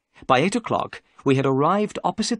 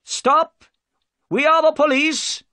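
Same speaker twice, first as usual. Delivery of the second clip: high, loud police shout; 6 dB louder than usual. Acted. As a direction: yell across the water to halt them. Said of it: "he cried".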